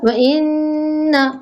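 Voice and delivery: इन्ना with the नन held two counts; slow ghunna on इन्ना; nasal ghunna held. Shown as a demonstration. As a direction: hold the nasal in इन्ना slowly. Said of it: "गुन्ना दो हरकत होगा".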